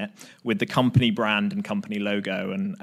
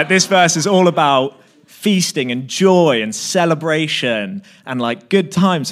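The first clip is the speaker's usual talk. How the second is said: loud, slow, overjoyed mock enthusiasm; gushing Pete; 5 semitones higher, 9 dB louder than usual. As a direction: ham up the friend gushing about feasting and joy. Pete enthusing about the verse, retold.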